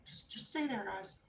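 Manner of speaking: barely voiced, careful instruction; very soft, tentative murmur; hushed command. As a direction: imitate mom gently holding the dogs back. Mom softly repeating command to dogs.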